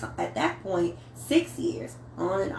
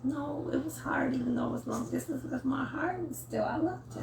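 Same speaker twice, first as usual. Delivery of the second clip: soft, whispered confession; whispering; 6 semitones higher, 7 dB quieter than usual. Real, not acted. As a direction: hush it out, tender. Admitting she still loved him.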